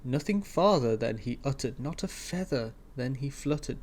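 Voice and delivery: ordinary delivery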